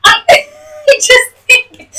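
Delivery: ordinary delivery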